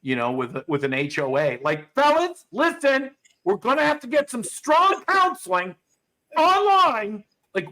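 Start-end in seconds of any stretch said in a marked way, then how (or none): 1.9-7.2 high, loud prim mom voice; suburban mom impression. Mocking a suburban mom scolding her fighting dogs, high prim coo.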